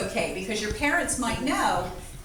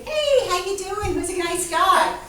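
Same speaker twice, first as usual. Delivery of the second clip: gentle polite greeting; 7 semitones higher, 4 dB louder than usual. Shown as a demonstration. Greeting a calm dog with an outstretched hand, gently. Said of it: "you reach out to the dog".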